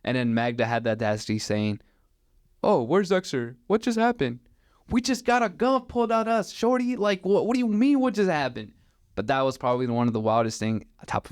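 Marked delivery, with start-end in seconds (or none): 2.6-4.4 mocked clueless friend. Oblivious friend's question, mocked. 4.9-8.7 high-pitched, incredulous retort; exasperated disbelief. Retold or imitated. Driver answering his oblivious friend after the gun scare, exasperated.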